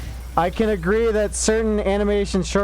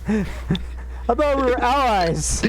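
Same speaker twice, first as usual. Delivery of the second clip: high mock hurt; 7 semitones higher than usual. Joking to a friend about British allies, high mock hurt. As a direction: play the betrayed ally.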